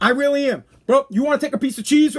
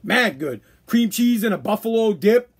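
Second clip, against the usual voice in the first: ordinary talk, nothing marked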